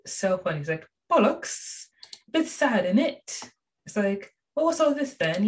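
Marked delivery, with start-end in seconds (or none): 1.1-3.5 high, mock British accent, played for laughs; lightly hammed British Steven voice. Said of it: "Steven's British accent". 4.5-5.5 high mock British accent; a playful British accent. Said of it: "Steven's British accent".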